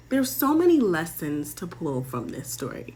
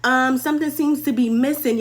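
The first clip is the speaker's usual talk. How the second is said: higher, louder acted call; raised-voice "hold up" act; servants calling out; 6 semitones higher, 5 dB louder than usual. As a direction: call after them as a servant running up. Servants come running, calling out.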